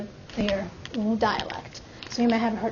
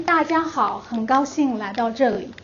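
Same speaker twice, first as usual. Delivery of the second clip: standard Mandarin; 3 dB louder than usual. Shown as a demonstration. Teacher showing the class the sentence again, in standard Mandarin. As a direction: clear standard Mandarin, lifted opening. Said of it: "And again, in Mandarin".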